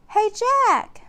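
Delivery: put-on young girl hailing her brother; calling out. A narrator's reading. Annie calling to Jack from the hilltop, loud and high. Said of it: "Annie called".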